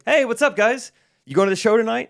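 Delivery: high-pitched, upbeat retold hello; friendly greeting. Performer greets a couple at the elevator, friendly. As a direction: hail them cheerily, pitched well up.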